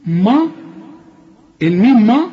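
normal talking voice

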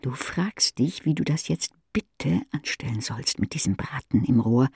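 usual speaking voice

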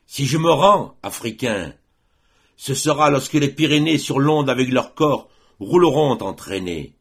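loud, high heroic defiance; roaring defiance. A narrator's reading. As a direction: roar it back like a tiger, easing on the last word. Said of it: "Il rugit comme un tigre". (rugissement de défi, fort et aigu)

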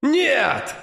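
the monsters shriek in his head; shrieking voices. A narrator's reading. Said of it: "визжат чёртовы голоса в голове". (визг демонических голосов)

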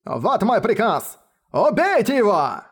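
high, slow shouted command. Acted. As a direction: hold up the token and roar. Raises token, orders kill.

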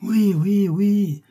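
very high, loud chorus of agreement; eager cry. A narrator's reading. Young guests backing the order, eager cry. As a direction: cry out like a crowd of excited young people. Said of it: "s'écrièrent tous les jeunes gens".

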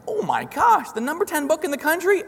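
high, astonished gasp of disbelief; stunned high-pitched oh my gosh; amazed. Retold or imitated. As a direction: say it like you can't believe your eyes. Amazed dad reacting to ranking.

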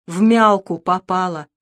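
ordinary delivery

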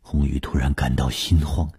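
usual speaking voice

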